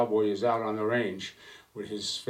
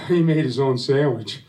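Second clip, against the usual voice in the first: louder, a mourner blurting out the reason; loud punchline; 4 semitones higher, 10 dB louder than usual. Acted. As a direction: hit the punchline hard and loud.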